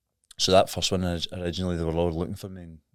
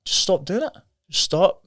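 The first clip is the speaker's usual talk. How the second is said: much higher, impatient mock scolding; exasperated advice; 11 semitones higher, 7 dB louder than usual. Imagined outsiders telling the addict to quit, exasperated scolding. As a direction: bark it like people who don't understand.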